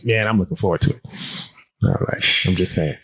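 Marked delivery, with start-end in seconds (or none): 1.8-3.0 much lower, a deep put-on hey; lowered joke voice greeting the listeners. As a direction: drop the voice low for a joke greeting. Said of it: "Oh, back to my voice".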